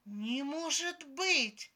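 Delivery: loud, high, drawn-out disbelief; desperate cry. A narrator's reading. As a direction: cry out slowly in despairing shock. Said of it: "отчаянно вскрикнула моя девушка".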